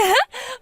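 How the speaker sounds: a short high gloating laugh; gloating cackle. Acted. Sister-in-law cackling over the ruined wedding dress, gloating. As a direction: cackle at her misfortune.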